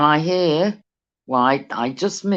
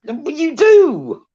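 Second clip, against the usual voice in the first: slow, higher and louder cheeky agreement; 11 semitones higher, 7 dB louder, slower than usual. Real, not acted. Agreeing cheekily with co-host who says he brings out her naughty side. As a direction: drawl it out with a cheeky grin. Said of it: "I bring the naughty out of you".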